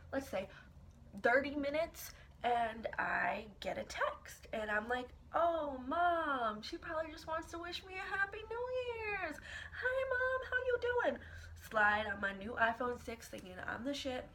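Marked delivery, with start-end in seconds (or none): 5.3-11.2 high, chirpy, sweet-daughter voice; overly sweet high greeting to mom; sugary cheerful greeting. Retold or imitated. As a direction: sing it up high like nothing's wrong.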